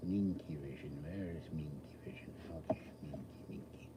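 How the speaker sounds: whispering to himself. Real, not acted. Searching the screen, whispering to himself.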